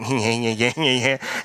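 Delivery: low nyeh-nyeh-nyeh noise; mock grumbling. Shown as a demonstration. Preacher demonstrating the sound of grumbling, mock grumbling. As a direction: grumble wordlessly in a put-on mocking voice. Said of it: "That's what grumbling sounds like".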